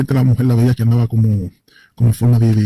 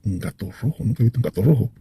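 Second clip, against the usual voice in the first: hushed thinking aloud, much softer; inner thought; 3 semitones lower, 6 dB quieter than usual. Retold or imitated. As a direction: say it to yourself, barely aloud. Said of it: "por dentro de mí como".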